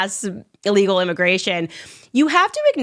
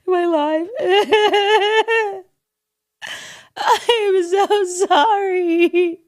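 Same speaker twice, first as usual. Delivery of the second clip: very high, hammed-up sobbing and laughing; fake-weeping starlet filming herself, wailing; mock crying celebrity; 12 semitones higher than usual. Mocking a celebrity crying on Instagram, high fake sobs. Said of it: "Think about the steps there".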